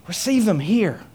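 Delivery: ordinary delivery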